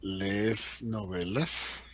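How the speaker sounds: ¿Lees novelas? drawn out slowly with a rise; slow question. Shown as a demonstration. Teacher models the Spanish question for students. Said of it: "Abro signo de interrogación".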